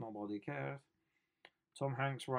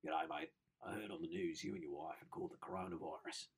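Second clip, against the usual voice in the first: a light, soft mock Australian accent; 5 semitones lower, 7 dB quieter than usual. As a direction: read the kid's letter in a hint of Australian. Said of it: "it's Australian".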